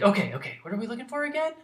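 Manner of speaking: put-on keen kid ready to help; Nimona eager. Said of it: "she's like".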